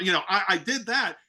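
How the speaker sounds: normal talking voice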